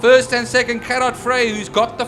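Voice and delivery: loud, energetic race call; motorsport commentator. Real, not acted. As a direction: call the lap over the crowd noise.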